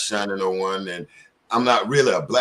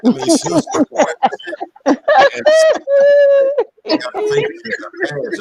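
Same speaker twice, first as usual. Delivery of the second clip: excited outburst; 10 dB louder than usual. Real, not acted.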